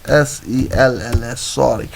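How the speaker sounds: ordinary talk, nothing marked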